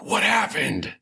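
high, airy confused voice; Adira's voice. Acted. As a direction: blurt it out, bewildered. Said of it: "'Cause she sounds like that".

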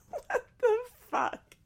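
very high, slow, cracking up; incredulous laughter. Real, not acted. Host reacting to the fantasy line, squealing with laughter. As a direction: squeal it out through helpless laughter.

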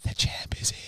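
whispered again, creepy; retold creepy whisper. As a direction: lean in and breathe it. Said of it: "whispered in his ear".

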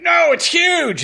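put-on high, loud, drawn-out child's boast; imitating boastful kid. Son correcting dad about his dragon, imitated boast. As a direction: crow it slowly like a proud kid.

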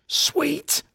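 high, hammed-up stage hiss of the boss; a squeaky melodramatic hiss. A narrator's reading. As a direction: hiss it like a bad spy. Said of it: "hissing at me melodramatically".

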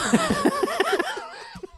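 shrieking laugh way up high. Real, not acted. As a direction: burst into a squealing laugh. Howls at the joke.

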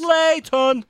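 high call. Real, not acted. Calling out Jason Layton name.

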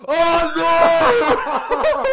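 loud, high cry of dismay breaking into laughter; loud laughing dismay. Real, not acted. Shocked realisation of forgotten episode.

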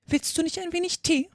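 high-pitched, mildly solemn offer; very earnest. A narrator's reading. Hatter offering Alice tea, earnest. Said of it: "sehr ernsthaft zu Alice".

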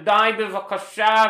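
put-on very slow pleading old lady; mock old lady. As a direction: stretch it out wearily.

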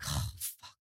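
breathy unvoiced whisper of dismay; whispered curse. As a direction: mutter it under your breath, no voice. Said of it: "I'm like".

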